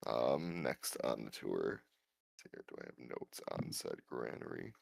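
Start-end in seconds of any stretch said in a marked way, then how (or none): 1.8-4.7 whispered to self; muttering aside. Real, not acted. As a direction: whisper while checking notes. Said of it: "checking notes aside".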